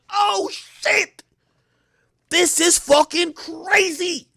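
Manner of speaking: very high, drawn-out amazement; awestruck shout. Retold or imitated. Sees a huge mansion, high slow amazed shout. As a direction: gasp at the mansion.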